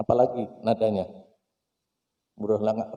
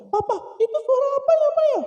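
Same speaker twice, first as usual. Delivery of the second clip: put-on very high childish voice; little child; 5 dB louder, faster than usual. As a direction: ask like a small kid. Small child asking. (suara anak kecil tinggi)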